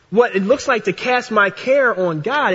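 usual speaking voice

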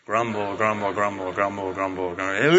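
very low, soft muttered chant; grumbling crowd. Shown as a demonstration. Congregation says it on count of three, low grumbling. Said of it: "I want everyone to say grumble five times".

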